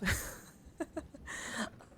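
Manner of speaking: helpless giggle at own dark remark; laughing. Real, not acted. Streamer laughing about a grim childhood card-game memory, giggling. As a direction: break into a giggle.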